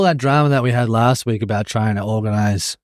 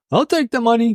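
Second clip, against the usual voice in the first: much higher put-on squeal of acceptance; retold own reaction; 12 semitones higher, 3 dB louder than usual. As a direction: act out grabbing the offer, pitched way up. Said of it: "So I was like".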